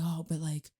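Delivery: half-whispered mock guilt; soft, mopey imitation of the listener; mock guilty voice. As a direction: mimic a self-pitying inner voice, softly. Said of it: "Be like".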